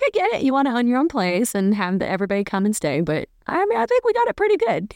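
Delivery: husband ribbing a friend, high and playful; teasing Neil impression. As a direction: gently talk him out of it in a high mock voice. Neil quoted talking Mark out of building.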